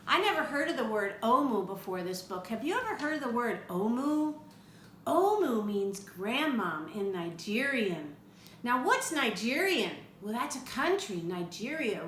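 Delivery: usual speaking voice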